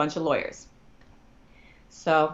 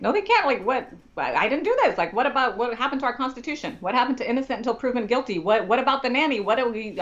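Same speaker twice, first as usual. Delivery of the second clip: high, racing string of disbelieving questions; incredulous protest; 8 semitones higher than usual. Retold or imitated. Client protesting to lawyer, high-pitched and incredulous. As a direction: pile up the objections in a raised pitch. Retold shocked argument with lawyer.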